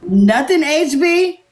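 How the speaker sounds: high, rising disbelief; incredulous. Real, not acted. Host checking guest would change nothing, incredulous. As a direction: question it in high-pitched surprise.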